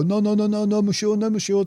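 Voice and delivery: high-pitched, hammed-up French accent; terrible French accent. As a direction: wave someone off in a bad mock-French voice. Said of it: "in my terrible French accent".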